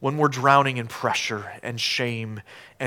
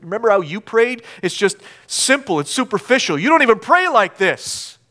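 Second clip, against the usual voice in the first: accusing voice climbing higher; mounting accusation; 7 semitones higher, 5 dB louder than usual. Acted. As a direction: build the taunts to a raised finish. Accuser's taunts building.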